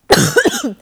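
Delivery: harsh loud cough; real coughing burst; coughing fit. Real, not acted. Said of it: "desculpa a minha tosse".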